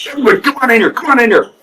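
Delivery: high, eager call to gather the team; rally the guys into the huddle; imitating a pumped captain; hyped-up captain calling. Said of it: "And Getchman's like".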